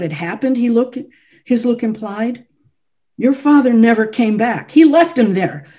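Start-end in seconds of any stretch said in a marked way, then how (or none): 3.2-5.7 louder, higher, accusing the guest's father; angry accusation. A narrator's reading. Chopin accusing the visitor's father, angry and loud. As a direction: let the anger rise in his voice. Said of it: "His eyes were afire".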